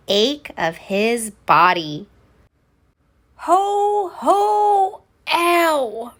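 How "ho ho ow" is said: high, strained ho ho breaking into ow; a jolly laugh that hurts; aching old Santa ho ho; pained Santa laugh. Acted.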